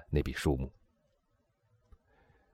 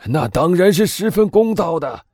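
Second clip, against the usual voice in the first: much higher, louder eager cry; loud exclamation; 14 semitones higher, 7 dB louder than usual. A narrator's reading. Boville eagerly exclaiming to the Englishman, loud. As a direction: warden loudly seizing the deal. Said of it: "波维利先生大声说道".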